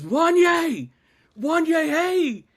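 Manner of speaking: high, loud mimicked fan shout; insistent hollering. Friend ignores correction and chases the singer, hollering insistently. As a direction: keep calling out to a star who isn't turning. Friend keeps calling the wrong name.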